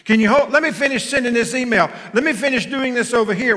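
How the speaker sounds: fast, high, loud put-off; busy brush-off. Retold or imitated. Husband mimicking his hurried brush-off, fast and high. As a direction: wave her off mid-task. Preacher voicing distracted reply again.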